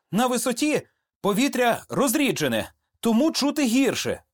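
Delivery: high-pitched attempt to sound sensible; nervous young soldier. A narrator's reading. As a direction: talk high to cover your nerves. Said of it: "спробував він відповісти щось розумне".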